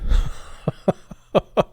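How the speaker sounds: breathy laugh. Real, not acted. Laughs at own silliness.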